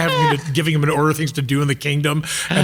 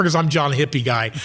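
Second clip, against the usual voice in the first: ordinary delivery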